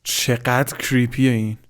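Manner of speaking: ordinary talk, nothing marked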